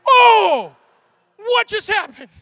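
high, very loud gasping shout; shocked crowd shout. Retold or imitated. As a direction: yell in stunned disbelief.

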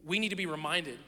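ordinary delivery

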